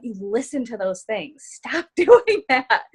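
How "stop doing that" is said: loud, slow command broken by a laugh. Real, not acted. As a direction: belt it out slowly with a laugh in the middle. Urging listeners to stop excuses.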